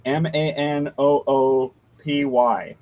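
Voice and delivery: each letter of "M A N O O P Y" said separately, slowly; spelled out. Shown as a demonstration. Reads name letter by letter.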